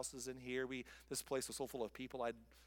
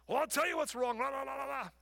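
high, loud mock rant; mock venting friend; ranting complainer; 11 semitones higher, 7 dB louder than usual. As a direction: launch into a loud tirade. Imitating someone venting loudly.

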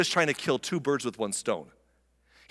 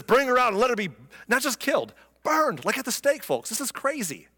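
pompous outrage; 8 semitones higher than usual. Retold or imitated. Judah's outraged order again.

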